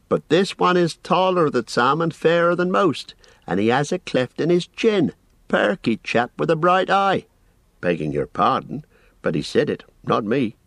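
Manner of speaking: a busy, apologetic innkeeper rambling on; fussy landlord. A narrator's reading. Landlord Butterbur voiced.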